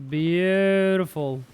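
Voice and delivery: long, lifted, pleased drawl; drawn-out delight. Real, not acted. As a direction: stretch the word right out with satisfaction. Savours good news in game.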